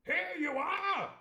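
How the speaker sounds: put-on a pompous squire hailing the boy, high and hearty; jolly squire. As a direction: boom it out like a delighted old squire. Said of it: "he cried".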